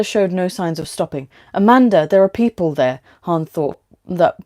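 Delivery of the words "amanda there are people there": urgent warning. A narrator's reading. Men standing in the road.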